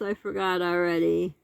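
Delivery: ordinary talk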